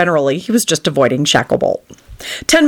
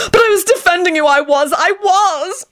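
heartbroken shriek; 13 semitones higher than usual. A narrator's reading. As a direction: plead in melodramatic heartbreak. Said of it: "shrieked in a heartbroken voice".

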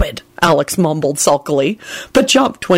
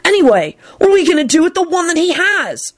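pitched-up impatient question; 11 semitones higher than usual. A narrator's reading. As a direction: push on impatiently to the problem. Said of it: "Tim responded impatiently".

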